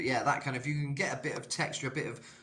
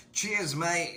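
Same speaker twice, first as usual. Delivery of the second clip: higher, louder mock Cockney; Cockney accent; 6 semitones higher, 5 dB louder than usual. Shown as a demonstration. Showing the British sound people like, higher louder Cockney. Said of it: "a Cockney kind of sound almost".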